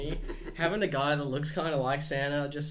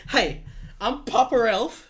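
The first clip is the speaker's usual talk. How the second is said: slow, high, loud elf voice; 6 semitones higher, 7 dB louder, slower than usual. Pretend. Playing Papa Elf.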